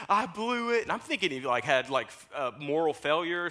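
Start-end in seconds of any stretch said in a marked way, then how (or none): 0.1-0.8 retold panic. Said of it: "he calls me in a panic".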